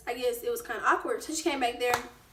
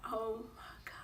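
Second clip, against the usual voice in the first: whispered oh my God, barely voiced; 13 dB quieter than usual. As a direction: whisper it in dread.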